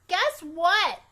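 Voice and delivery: put-on high, loud little girl; getting mother's attention; Junie B voice. A narrator's reading. Girl at bedtime calling her mother, high and loud. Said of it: "I said".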